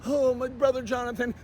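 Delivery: soft, mocking put-on voice; a syrupy fake plea, quietly; mock sweet plea. Mocking Spike Moss pleading with him, soft put-on voice.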